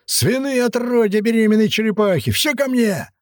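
furious high-pitched yelling; raging. A narrator's reading. Governor cursing his men, raging. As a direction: rage at them, voice pushed high. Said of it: "Наместник бушевал, рвал и металл".

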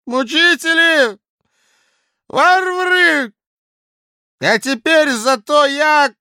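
put-on loud, high drunken bluster; drunken blustering official. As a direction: boast it loud like a tipsy clerk. Drunk Kozulin rants.

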